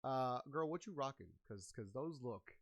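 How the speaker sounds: ordinary delivery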